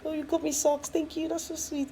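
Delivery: imitating husband's polite thanks. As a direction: play the oblivious husband, hammed-up. Voices husband again.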